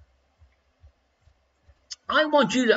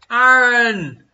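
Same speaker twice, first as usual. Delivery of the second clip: calling out loud; 3 semitones higher, 6 dB louder than usual. Real, not acted. Calling name loudest.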